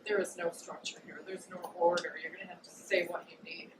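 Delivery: hushed, urgent whisper; fast whispered advice; whispered insistence. Retold or imitated. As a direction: whisper it firmly, like a secret warning. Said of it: "Uranus was like".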